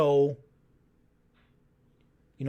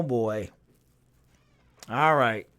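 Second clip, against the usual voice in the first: ordinary talk, nothing marked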